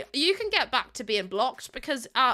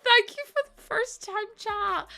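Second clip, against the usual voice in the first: high, tearful welcome; fighting back tears; 12 semitones higher, 5 dB louder than usual. Real, not acted. Streamer welcoming a first-time chatter, fighting back tears. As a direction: squeeze out thanks in a raised voice. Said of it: "I'm trying so hard not to cry".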